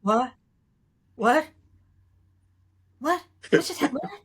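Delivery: bewildered, rising what-what; half-laughing disbelief at what just happened; mock shocked double take; stunned what. Shocked reaction on set retold.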